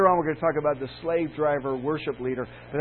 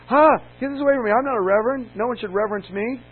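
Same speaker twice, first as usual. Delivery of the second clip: high, loud alarmed recoil; mock horrified young minister; startled protest; 7 semitones higher, 6 dB louder than usual. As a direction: push the letter away in alarm. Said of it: "I just went".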